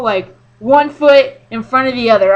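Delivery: normal talking voice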